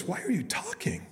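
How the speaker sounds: high-pitched, lightly acted hunter; startled hunter. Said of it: "the guy goes".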